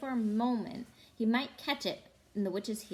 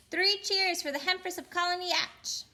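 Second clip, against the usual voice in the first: high mocking cheer; 9 semitones higher, 3 dB louder than usual. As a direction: jeer it up high. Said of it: "another voice said".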